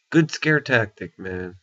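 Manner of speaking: ordinary talk, nothing marked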